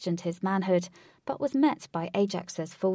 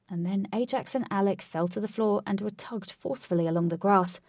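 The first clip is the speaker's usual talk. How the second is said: ordinary delivery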